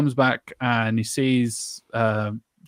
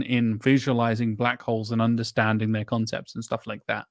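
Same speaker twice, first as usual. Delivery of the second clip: normal talking voice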